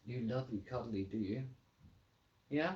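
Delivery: normal talking voice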